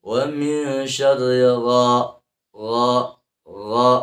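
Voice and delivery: slow chant into a heavy, forceful ghain; thick gha. Shown as a demonstration. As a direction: lean hard on a thick gha. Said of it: "Dibaca tebal". (gha dibaca tebal)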